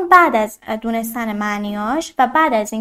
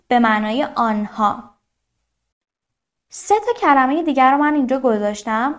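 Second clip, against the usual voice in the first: ordinary delivery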